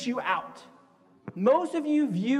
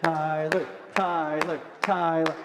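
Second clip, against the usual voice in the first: cheering; 6 semitones lower, slower than usual. Pretend. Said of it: "We can cheer him on".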